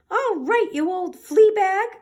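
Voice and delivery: high, loud squeaky mouse officer; little mouse officer barking orders at the dog. Acted. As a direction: squeak it out like a tiny bossy officer. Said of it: "squeaked the Big Cheese".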